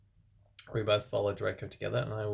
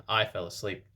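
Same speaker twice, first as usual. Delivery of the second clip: normal talking voice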